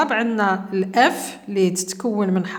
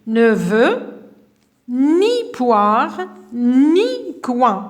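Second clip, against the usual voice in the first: slow example; 3 semitones higher, 4 dB louder, much slower than usual. Shown as a demonstration. Teacher demonstrating the double negation, very slowly. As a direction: stretch out the example for the class. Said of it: "مثلًا نقولوا".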